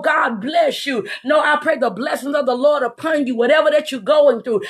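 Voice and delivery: usual speaking voice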